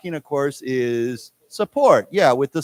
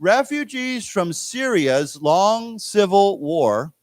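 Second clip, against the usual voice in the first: slow model sentence for repeating; reading together; 3 semitones higher, slower than usual. Shown as a demonstration. Teacher leading students reading together, slow careful model. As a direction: read it slowly, each word distinct. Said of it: "read this together".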